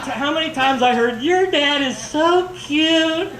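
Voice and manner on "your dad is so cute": high, drawn-out fawning coo; mock gushing. People telling speaker about his dad, mock gushing. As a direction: stretch it out slowly, imitating an admirer.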